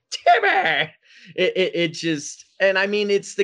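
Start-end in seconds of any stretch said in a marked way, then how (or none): 0.1-0.9 very high, strained Timmy cry; squeaky cartoon Timmy shout. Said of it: "and they're saying".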